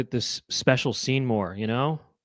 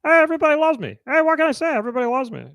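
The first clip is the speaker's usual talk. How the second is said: much higher Romano voice; Ray Romano impression; 10 semitones higher, 3 dB louder, faster than usual. Showing friends how Ray Romano sounds, much higher. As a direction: do Ray Romano, nasal-free and high. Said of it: "this is Ray Romano".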